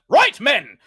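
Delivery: high, loud shouted order; rally the troops forward; battle command. Acted. Commander orders his men forward, shouting.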